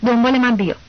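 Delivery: a captain holding in his rage; through clenched teeth. A narrator's reading. Captain orders the boy to follow, through clenched teeth.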